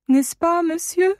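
put-on high child's voice, "comme cela" light and coquettish; French little girl. A narrator's reading. Adèle asking her guardian if she does it like maman, high and coquettish. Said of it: "dodała".

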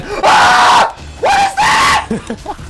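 high shrieking scream; scared scream. Real, not acted. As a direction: scream in real fright. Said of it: "It just scared me".

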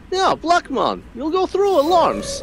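imitating Russian. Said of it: "remember that time I did Russian".

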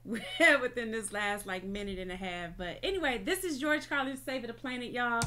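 ordinary talk, nothing marked

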